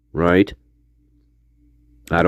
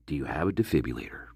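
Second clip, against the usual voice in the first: quick, much softer and lower; a hushed, hurried mock quote of the 911 caller; 12 semitones lower, 13 dB quieter, faster than usual. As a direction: toss off the caller's line under your breath. Mocking the caller's odd question.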